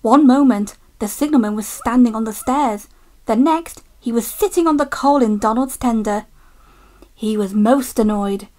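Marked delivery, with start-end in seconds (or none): none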